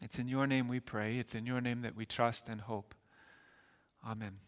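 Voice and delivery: soft, hushed closing prayer. Acted. Pastor closing a prayer before the sermon, softly. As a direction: pray quietly over the congregation.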